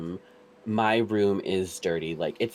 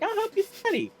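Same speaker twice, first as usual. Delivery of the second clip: very high squeaky sassy voice; squeaky mock voice. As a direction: squeak it out eagerly.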